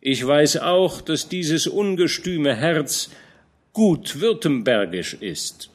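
old general scolding a young knight, lightly voiced; lightly voiced old commander. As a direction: admonish the young man gently but firmly. Fronsberg teases Georg.

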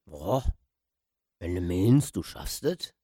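put-on doubtful old man.

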